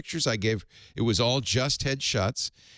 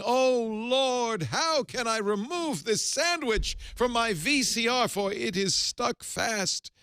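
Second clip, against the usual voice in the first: much higher, very slow mock plea to the Lord; hammed-up pleading man; 10 semitones higher, slower than usual. Troubled man crying out to the Lord, hammed-up plea. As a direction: wail to heaven in mock despair. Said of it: "so I'll do the accent of God".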